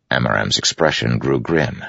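ordinary delivery, nothing marked

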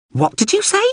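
very high, small robot voice; startled little drone. Acted. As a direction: squeak the question in disbelief.

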